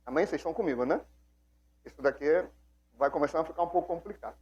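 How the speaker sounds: ordinary talk, nothing marked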